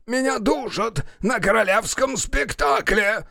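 high, strained theatrical yell; choked, high-pitched protest; crying out. Acted. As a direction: cry out as if being throttled. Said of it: "Бутон кричит".

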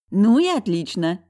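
loud, drawn-out cheer; delighted. A narrator's reading. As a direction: rejoice loudly, stretching the words. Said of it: "обрадовалась Светуля". (громко, радостно, нараспев)

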